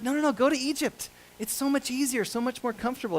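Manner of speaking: high, coaxing plea; urging. Acted. The easy way tempts Isaac toward Egypt, urging.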